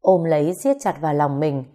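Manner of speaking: usual speaking voice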